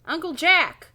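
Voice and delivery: calling out. A narrator's reading. Jason looking for his uncle in the store, calling out. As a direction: call out for your uncle. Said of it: "Jason calls".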